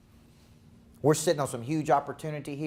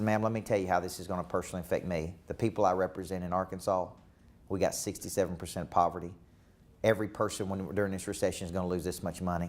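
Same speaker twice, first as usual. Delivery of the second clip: lower, softer, earnest and close; Clinton's sincere voice; 5 semitones lower, 4 dB quieter than usual. Retold or imitated. Coach quoting Clinton talking softly to a voter. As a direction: speak quietly straight to her like Clinton. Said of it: "And he said".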